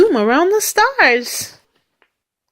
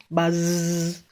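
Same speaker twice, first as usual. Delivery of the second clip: drawn-out, softer buzz; bee buzz; 4 semitones lower, 6 dB quieter than usual. A narrator's reading. Reader shows how Mister Brown buzzes, drawn-out bee sound. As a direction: hold the zz like a bee. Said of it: "He can sound like a bee".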